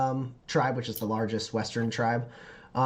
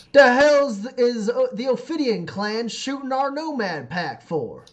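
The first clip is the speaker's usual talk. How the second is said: high, loud, indignant shout; angry outburst; 11 semitones higher, 6 dB louder than usual. Acted. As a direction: yell in outrage at the attack. Duke angrily questions the clan.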